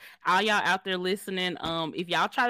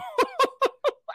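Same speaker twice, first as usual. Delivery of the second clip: high laugh. Real, not acted. Laughs about internet.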